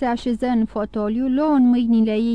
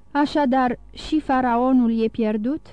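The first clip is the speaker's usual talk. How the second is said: usual speaking voice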